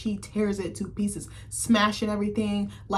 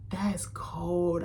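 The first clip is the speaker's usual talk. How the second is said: slow, whispered reaction; hushed disbelief; 5 dB quieter, slower than usual. Retold or imitated. Viewer reacting to a cold line, hushed disbelief. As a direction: drop to a slow, appalled whisper.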